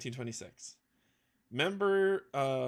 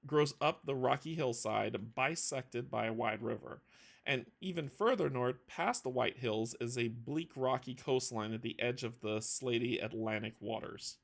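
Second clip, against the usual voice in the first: usual speaking voice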